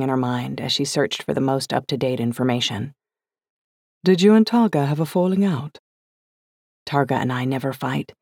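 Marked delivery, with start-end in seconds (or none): 4.0-5.8 higher, affected English question; aristocratic English accent. A narrator's reading. Liz asks daughter about her best friend, aristocratic English accent. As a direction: ask it in put-on upper-crust English. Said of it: "manufactured aristocratic English accent".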